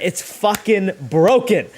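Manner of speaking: ordinary talk, nothing marked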